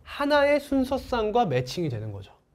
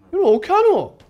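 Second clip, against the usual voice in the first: high, loud burst of mock dismay; mock exasperation; 11 semitones higher, 8 dB louder than usual. Teacher faced with listing unknown set elements, loud mock dismay. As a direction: cry out in playful disbelief, pitched way up.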